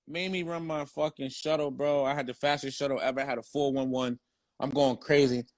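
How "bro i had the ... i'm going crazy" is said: ordinary talk, nothing marked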